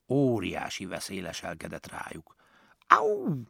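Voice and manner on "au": sudden very high ouch from a sting; cry of pain. A narrator's reading. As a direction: yelp as if stung. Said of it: "kiáltott fel Szenilla".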